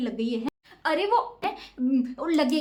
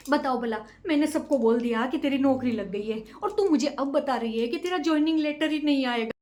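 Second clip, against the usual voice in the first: ordinary talk, nothing marked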